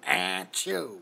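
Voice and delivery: low mock sneeze; Texan sneeze. Imitating a Texan sneeze for viewers, low mock sneeze. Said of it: "Or if you're from Texas".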